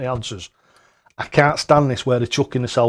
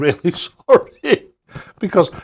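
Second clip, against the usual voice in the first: laughing apology; 7 semitones higher, 4 dB louder, slower than usual. Real, not acted.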